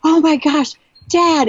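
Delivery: high-pitched emotional cry to dad; excited yelling. Retold or imitated. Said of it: "I was yelling at him".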